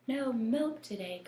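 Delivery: crying out. Acted. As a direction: cry it out like a shocked farmer. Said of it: "cried Farmer Brown".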